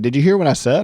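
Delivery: a hint of the irritated wife checking he listened; mock wife.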